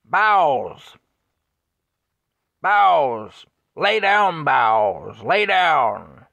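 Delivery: loud, high commanding shouts; yelling at the bull. Retold or imitated. Passenger yelling out the car window at the bull, loud commanding shout. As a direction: bellow a command to the charging bull. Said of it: "he yelled out the window".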